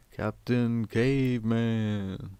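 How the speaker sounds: long, drawn-out cartoon battle cry at modest volume; slow mock holler, vowels stretched right out; Captain Caveman shout. Recalling a cartoon hero for listeners, mock drawn-out shout. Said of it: "He would shout in that".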